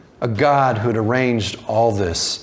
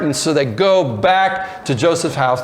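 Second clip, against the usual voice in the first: normal talking voice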